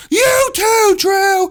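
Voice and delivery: high, loud call across the room; teacher's loud order. Retold or imitated. Teacher calls out Drew to run too, loud high call. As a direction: drop it on him like a verdict.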